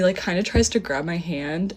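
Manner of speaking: ordinary talk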